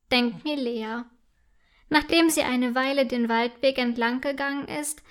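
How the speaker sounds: ordinary delivery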